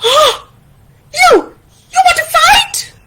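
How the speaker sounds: gasp, then squeaky outraged challenge; high-pitched sputtering fury; Taín, challenging. Acted. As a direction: dare your friend in a very high voice. Challenge to fight in story.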